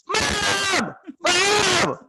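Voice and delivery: very high mock scream; loud, high, hammed-up wail; kid yelling for mom. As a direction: act out a hurt child shrieking for mother. Hurt kid calls mother, acted.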